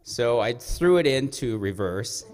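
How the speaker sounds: normal talking voice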